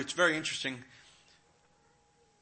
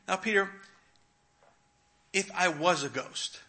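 usual speaking voice

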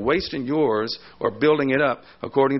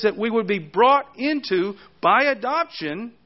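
raised-pitch, driving sermon delivery; emphatic preaching; 7 semitones higher than usual. Real, not acted. Preacher expounding the verses to the congregation, emphatic and high. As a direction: preach it high, punching the key words.

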